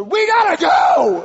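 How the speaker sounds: high, drawn-out panicked shout; urgent yell. Retold or imitated. Husband warning wife about oncoming ship, urgent high yell. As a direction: yell it back over your shoulder. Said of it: "I just yelled over my shoulder".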